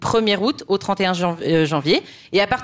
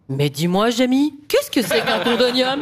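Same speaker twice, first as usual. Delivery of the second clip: inquisitive TV science presenter; Fred; 3 semitones higher than usual. Acted. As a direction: pitch climbing into an exaggerated question. Imitates TV science show presenters.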